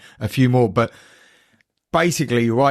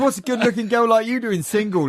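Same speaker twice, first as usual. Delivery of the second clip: a higher, lightly mocked leering host; imitating the host; 10 semitones higher than usual. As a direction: play the cheesy host chatting her up. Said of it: "Then he goes".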